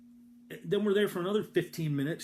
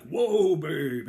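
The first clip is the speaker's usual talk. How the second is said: mule driver's call; 8 dB louder than usual. Retold or imitated. Old man halting his mule with a loud call. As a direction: holler "Whoa" to stop the mule, then drop.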